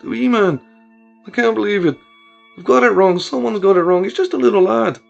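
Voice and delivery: weeping. Acted. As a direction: sob out the denial. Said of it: "Kieran's weeps began to spill over".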